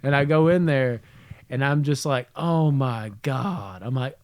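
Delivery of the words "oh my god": long drawn-out feigned dismay; mock horror. Prankster viewing smudge with brother, mock-horrified. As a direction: stretch every word in pretend shock.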